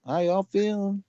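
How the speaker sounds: very high, hyped-up greeting; sitcom host impression. Fan imitating the show's opening, high put-on hype voice.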